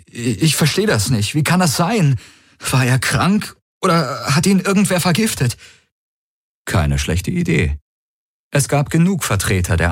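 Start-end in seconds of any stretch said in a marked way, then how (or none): 0.1-5.6 high, distraught questions; acted bewilderment. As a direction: play the shocked friend, pleading for answers. Feigned bewildered grief, questioning guard.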